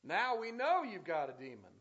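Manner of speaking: loud, scornful taunt; mocking exclamation. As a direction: throw the accusation out as a sneer. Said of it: "there's an exclamation there 'cause I think that's the way it sounded".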